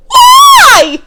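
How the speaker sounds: shrieked, very high and loud; shocked squeal at the guns; alarmed why. Retold or imitated.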